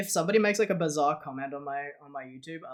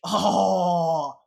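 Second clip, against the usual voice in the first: drawn-out, louder hammed-up clickbait narrator; mock YouTube title announcer; 2 semitones higher, 8 dB louder, much slower than usual. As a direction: announce it like a dramatic video title, slowly. Mock clickbait video title read.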